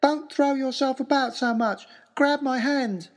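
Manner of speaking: put-on high, clear young man's voice; Harry's clear high voice. Harry pulling the narrator off the ice, high clear voice. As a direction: call out calmly but urgently, high and clear. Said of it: "Harry's clear, high voice".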